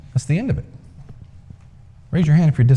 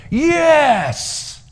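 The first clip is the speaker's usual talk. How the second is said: crowd shout. A narrator's reading. Other half shouts.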